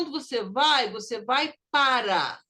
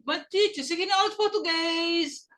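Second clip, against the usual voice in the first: high, quick student's protest; complaining student; 7 semitones higher, faster than usual. Acted. Teacher imitating a student objecting to Portuguese, high-pitched complaint. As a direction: mimic a pupil objecting, drawing out the last word.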